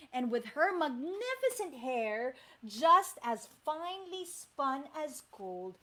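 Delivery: plain reading voice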